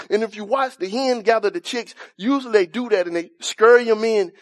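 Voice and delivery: ordinary talk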